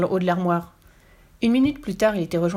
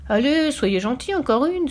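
put-on slow, high, wheedling child's plea; begging; 7 semitones higher, 3 dB louder, slower than usual. A narrator's reading. Girl begging tired men for another dance, pleading. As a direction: stretch it out coaxingly, rising high. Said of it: "supplia Fifi".

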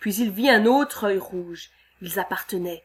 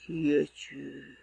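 much lower, slow, soft creature voice; deep monster voice; 6 semitones lower, 7 dB quieter, much slower than usual. Acted. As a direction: rumble it slowly like a huge beast. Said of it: "demanda une voix profonde".

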